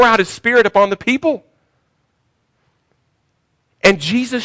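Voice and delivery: high, urgent preacher's delivery; fired-up sermon climax; impassioned preaching. Real, not acted. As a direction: drive the revelation home with rising fervor. Preaches at full force.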